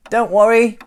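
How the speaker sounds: high, loud reassuring call; cries out. Acted. Stickman calling up to stuck Santa, loud and reassuring. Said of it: "cries Stickman".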